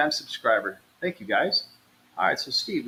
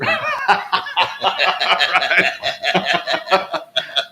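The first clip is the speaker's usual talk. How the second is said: long hearty laugh; real, drawn-out laughter; 6 semitones higher, 4 dB louder than usual. Real, not acted. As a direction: burst out laughing at a joke. Laughing at the joke about reruns.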